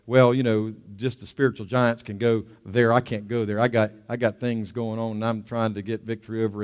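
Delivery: low, soft, self-doubting excuses; defeated believer. Acted. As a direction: make subdued excuses, as if beaten. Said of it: "stay in this inferiority complex".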